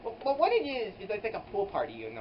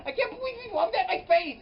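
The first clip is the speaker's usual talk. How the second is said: indignant squeal; 13 semitones higher, 7 dB louder than usual. Retold or imitated. Quoting own indignation.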